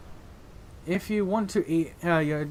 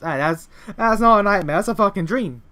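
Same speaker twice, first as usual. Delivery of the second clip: loud, higher indignant rant; excited outburst; 4 semitones higher, 5 dB louder than usual. Real, not acted. Player arguing about the nightmare, excited outburst. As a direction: insist it loudly, worked up.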